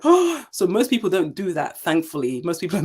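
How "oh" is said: shocked gasp. Retold or imitated. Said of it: "people are like".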